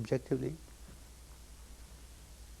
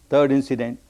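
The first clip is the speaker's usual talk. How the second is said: ordinary talk, nothing marked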